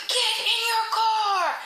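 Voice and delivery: high, drawn-out shouted order; mock police yell. Police officer ordering dad into his car, mock drawn-out yell. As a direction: holler it slowly like an officer ordering someone.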